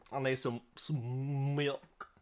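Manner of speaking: slow, dragged-out mock plea; slow drawn out. GM joking that she needs milk, slowly drawn out. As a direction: stretch every word slowly.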